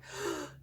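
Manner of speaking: quick unvoiced gasp of cold air; a short demonstrated gasp; sharp gasp. Shown as a demonstration. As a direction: suck the breath in sharply, as if hit by icy air. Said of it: "really suck in your breath".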